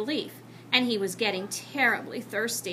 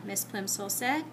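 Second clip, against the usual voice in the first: plain reading voice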